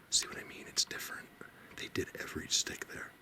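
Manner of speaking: whispering so as not to disturb anything; breathy hush, barely voiced. Real, not acted. Vlogger creeping toward the structures in a whisper.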